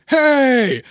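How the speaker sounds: mock yell, pitched high; drawn-out, half-hearted outraged "hey"; belated indignant yell. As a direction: imitate a big dim man's delayed protest. Uncle belatedly notices the punch.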